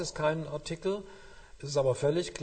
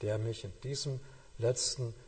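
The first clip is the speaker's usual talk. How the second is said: tender hush; 2 semitones lower, 7 dB quieter than usual. Shown as a demonstration. Tender closing of the thought.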